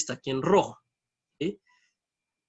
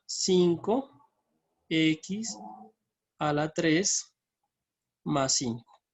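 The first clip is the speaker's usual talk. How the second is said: slow, spaced-out terms; long pauses between each term; piecemeal dictation; much slower than usual. Real, not acted. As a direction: dictate it bit by bit while typing. Dictates formula while typing it.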